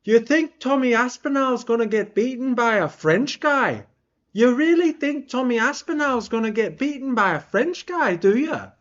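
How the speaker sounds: high-pitched cocky trash talk; mock fighter's taunt on the mic; Tom Aspinall. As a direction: challenge them in a high put-on voice. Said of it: "Aspinall gets the mic and just goes".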